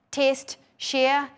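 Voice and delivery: ordinary talk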